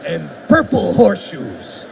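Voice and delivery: wrestling commentator voice. Pretend. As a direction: call each item like a ring announcer. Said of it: "like a wrestling commentator type voice".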